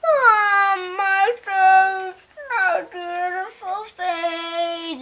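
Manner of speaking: high crying wail that fades into a sung lament; mock-sobbing witch mourning her face; hammed-up dying witch; melting witch wail. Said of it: "And she's like".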